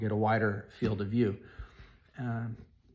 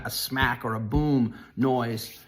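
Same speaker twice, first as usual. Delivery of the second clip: ordinary talk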